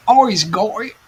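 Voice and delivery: put-on high, drawn-out Australian accent; mock Australian accent. Teasing a viewer from Australia in a mock Australian accent. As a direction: ham up an Aussie voice, high and slow. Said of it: "I love the Australian accent".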